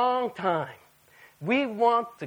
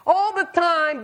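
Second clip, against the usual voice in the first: ordinary talk, nothing marked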